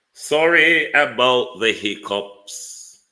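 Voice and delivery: slow and loud, stretching it out; loud drawn-out apology. Real, not acted. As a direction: drag out the apology loudly.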